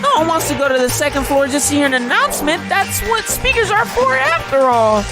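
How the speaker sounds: sing-song menace like a game show villain; fighting game announcer. Acted. Plays the Killing Game announcer.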